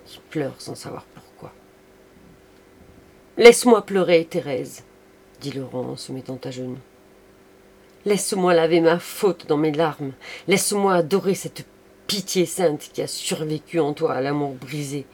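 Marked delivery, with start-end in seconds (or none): 3.4-4.8 loud, high outburst falling away on the name; impassioned plea. Acted. Kneeling lover begs in tears. 8.1-15.1 loud, raised voice, pouring out remorse; impassioned plea. Acted. Kneeling lover begs forgiveness.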